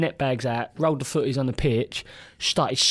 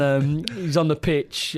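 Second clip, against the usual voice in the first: ordinary talk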